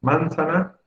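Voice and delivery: "Manzana" stressed on MAN, high pitched; stress on first syllable. Shown as a demonstration. Teacher testing stresses for the class, MAN stressed in "Manzana". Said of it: "¿Cómo se pronuncia manzana?".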